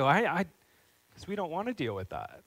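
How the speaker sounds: usual speaking voice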